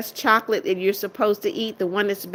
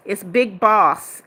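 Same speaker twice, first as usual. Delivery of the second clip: slow, firm stress on Boss; setting a viewer straight on the name, slow and firm; correcting the name; much slower than usual. Real, not acted. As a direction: spell out Boss slowly as a correction. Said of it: "It's not Big Man".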